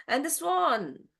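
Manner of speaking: usual speaking voice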